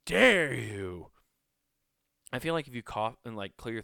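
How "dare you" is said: high, loud, hammed-up how dare you; mock outrage. Offended host to the throat-clearer, hammed-up outrage. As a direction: play the wounded speaker, over the top. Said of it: "They're like".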